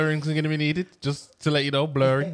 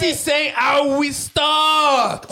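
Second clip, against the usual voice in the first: slow shout; 6 semitones higher, slower than usual. Real, not acted. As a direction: shout them down slowly. Host shouts to restore order.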